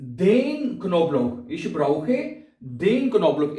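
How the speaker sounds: very slow, each word set apart; slow model sentence. Shown as a demonstration. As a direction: spell it out slowly for the student. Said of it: "और यहाँ पे हम लिखेंगे". (धीरे धीरे, हर शब्द अलग)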